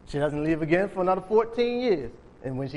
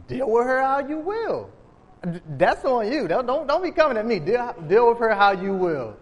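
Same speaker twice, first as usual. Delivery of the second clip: dismissive Abraham; 6 semitones higher than usual. Retold or imitated. Abraham brushing off Sarai about Hagar, mock dismissive. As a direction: brush the problem off like a man who wants no part of it. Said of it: "And Abraham's like".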